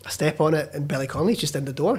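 ordinary talk, nothing marked

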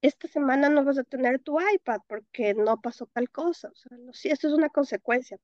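normal talking voice

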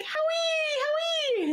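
high squealing fan call of his name; screaming fans. Retold or imitated. Fans in South America screaming for [name], high squeal.

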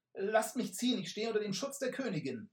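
high, loud, fast and frightened; fearful scream. Acted. Messenger screams in fear, invoking the queen. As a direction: plead in terror for safe passage. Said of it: "schrie die angsterfüllte Stimme".

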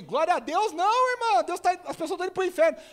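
high, loud mock scolding; hammed-up pulpit rebuke; imitated exasperated preacher. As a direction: shout the correction at the congregation like a preacher. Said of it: "Aí o pregador falou assim".

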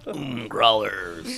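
slow, low goofy drawl; silly clown. Pretend. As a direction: draw it out like a clown. Goofy segment intro.

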